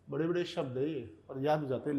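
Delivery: usual speaking voice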